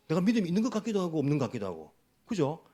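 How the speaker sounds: usual speaking voice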